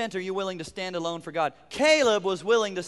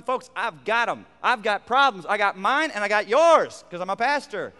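ordinary delivery

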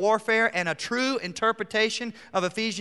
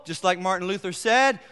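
usual speaking voice

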